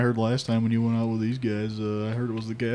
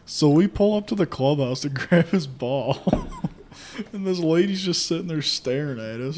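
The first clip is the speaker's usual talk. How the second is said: ordinary talk, nothing marked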